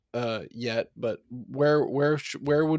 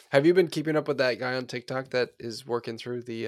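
ordinary delivery

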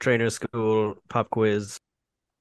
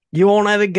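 a much higher, louder hammed-up Australian accent; louder Australian accent retry; 10 semitones higher, 7 dB louder, faster than usual. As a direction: shout it like a rowdy Aussie. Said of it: "Let me see".

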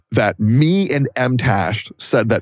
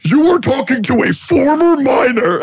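exaggerated impersonation; 13 semitones higher, 3 dB louder than usual. Streamer showing how the critic said it, exaggerated impersonation. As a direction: mimic his scandalised outburst, pitched way up. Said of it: "he literally said it like this".

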